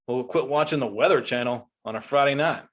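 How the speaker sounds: usual speaking voice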